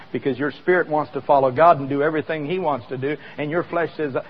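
normal talking voice

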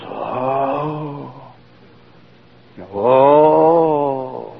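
long, swelling, drawn-out mock gasp of awe; crowd's awed "oh". Crowd hearing the boy will walk, imitated awed oohing. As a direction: imitate an amazed crowd's ooh. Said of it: "everybody goes".

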